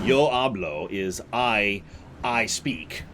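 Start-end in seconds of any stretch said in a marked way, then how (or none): 0.0-0.9 "Yo hablo" spelled out slowly, yo stressed; slow Spanish demo. Shown as a demonstration. Streamer explaining Spanish to chat, slow clear "Yo hablo". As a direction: say "Yo hablo" deliberately, like a teacher. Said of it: "I don't need to say".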